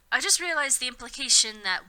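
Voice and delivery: ordinary talk, nothing marked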